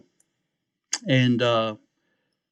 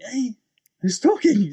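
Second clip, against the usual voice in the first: high-pitched, puzzled call-out; startled; 11 semitones higher, 4 dB louder than usual. Retold or imitated. Hearing a voice behind the curtains, startled. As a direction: raise your voice to find out who's there.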